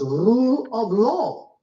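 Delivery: "rule of law" drawn out slowly, word by word; emphasis on RULE OF LAW. Shown as a demonstration. Lecturer pointing listeners to a key phrase, "rule of law" said with slow emphasis. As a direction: stress each word of "rule of law" with deliberate slowness. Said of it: "I just want to emphasize the words".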